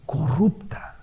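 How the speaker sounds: a softly whispered condemnation. Real, not acted. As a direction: breathe the word out like a verdict. Single whispered verdict.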